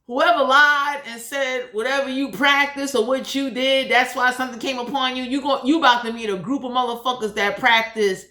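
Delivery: usual speaking voice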